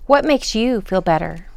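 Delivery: plain reading voice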